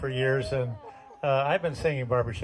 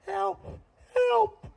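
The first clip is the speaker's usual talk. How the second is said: very high, small cry for help; tiny frog voice. Retold or imitated. Little frog on a log calls for help, very high squeak. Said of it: "we heard this little voice".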